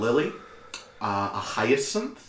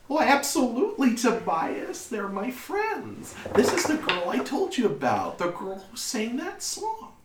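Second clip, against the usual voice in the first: high-pitched, gushing mother voice; high, eager motherly chatter; delighted mother; 10 semitones higher than usual. Acted. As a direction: assure your son in a high voice.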